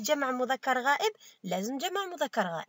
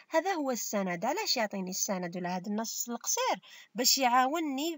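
ordinary delivery

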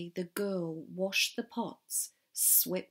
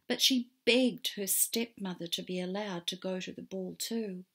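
usual speaking voice

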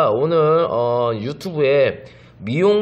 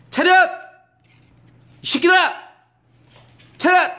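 high barking shout of attention; mock drill sergeant yell; 4 dB louder than usual. Owner yelling commands at the puppies, mock drill shout. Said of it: "오빠 소리 한 번만 지를게".

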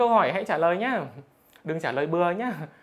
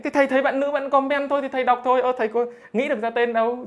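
defensive; 6 semitones higher, 3 dB louder than usual. Real, not acted. Teacher defending himself to student.

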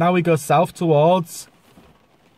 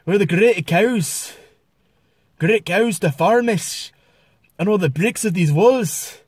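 lilting Scottish voice, swinging higher as it goes; Scottish accent; 3 semitones higher, slower than usual. Shown as a demonstration. Vlogger showing off accent impressions, Scottish. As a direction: put on a drawn-out Scottish accent. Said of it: "obviously Scotland".

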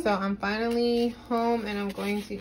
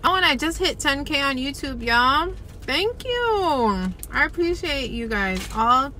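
excited; 6 semitones higher, 4 dB louder than usual. Real, not acted. Celebrates ten K subscribers.

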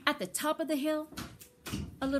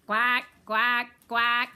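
loud quacking duck greeting at the door; 7 dB louder, slower than usual. Acted. Little Duck greets guests.

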